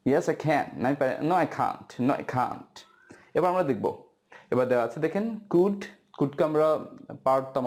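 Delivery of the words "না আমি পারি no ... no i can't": ordinary talk, nothing marked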